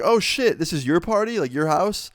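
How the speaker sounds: high-pitched startled realisation; re-enacted surprise. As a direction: act out being caught off guard. Acts out surprise at learning host.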